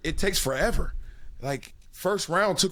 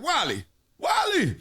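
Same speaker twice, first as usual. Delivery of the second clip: high, raised call of his name; 9 semitones higher than usual. Retold or imitated. Coaches calling a dozing player at halftime, raised call. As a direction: snap him awake by name.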